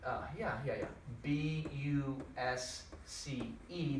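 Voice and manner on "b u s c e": very slow, soft letter by letter; slow spelled letters. Shown as a demonstration. Teacher to class, spelling the wrong form quietly. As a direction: drag out each letter quietly. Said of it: "that doesn't say busque".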